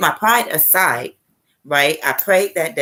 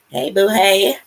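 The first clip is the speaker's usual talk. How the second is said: lightly voiced sweet hello; a playful high hello to an old friend; bright little hey boo; greeting; 4 semitones higher, 3 dB louder, slower than usual. Greeting late friend in memory.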